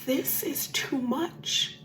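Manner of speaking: slow, soft, drawn out; hushed slow distress; overwhelmed plea. Retold or imitated. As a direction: let each word sag, overwhelmed. Overwhelmed during ceremony.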